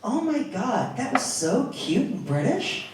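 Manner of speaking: smitten estate agent. Retold or imitated. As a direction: gush softly as the charmed agent. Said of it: "she turned to me and goes".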